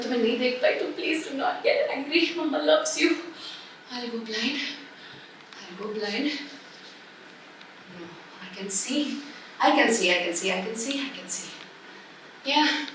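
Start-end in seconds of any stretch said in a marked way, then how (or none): 3.9-6.3 very soft self-pitying murmur; quiet fretting. Acted. Mother with soap stinging eyes, fretting quietly. 8.0-11.5 soft, low repeated murmur; hushed self-reassurance. Acted. Mother with soap in eyes, quietly reassuring herself. Checks her sight after soap.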